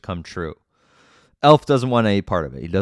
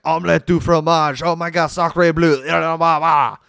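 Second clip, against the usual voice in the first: higher, louder hammed-up French accent; mock a cartoonish French accent; overdone fake French accent for laughs; terrible French accent; 7 semitones higher, 5 dB louder than usual. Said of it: "He has a terrible French accent the entire time".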